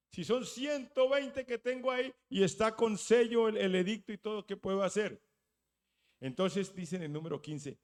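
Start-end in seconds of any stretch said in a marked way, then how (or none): 0.1-5.2 desperate king. Acted. King's anguished question.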